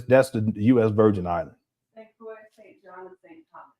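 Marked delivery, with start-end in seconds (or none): 2.0-3.7 under breath. Real, not acted. Reading wife's text aloud.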